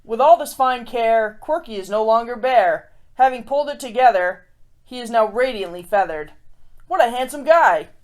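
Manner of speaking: lively rhyming read-aloud; storybook reading. A narrator's reading. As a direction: read it like a picture book to kids. Reads a rhyming picture book.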